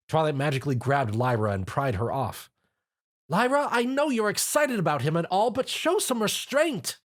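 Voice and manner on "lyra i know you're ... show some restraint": high exasperated, slowing at end; complaining. A narrator's reading. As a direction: scold her with a sigh. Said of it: "Twilight complained".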